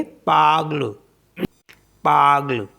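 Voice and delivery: पागल with the final E silent; French parle, clipped ending; French pronunciation. Shown as a demonstration. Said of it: "इसको भी silent कर देंगे".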